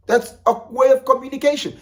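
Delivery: high, loud punchy point; emphatic. Real, not acted. Vlogger stressing a point to viewers, high and loud. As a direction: hammer home the point. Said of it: "emphatic point".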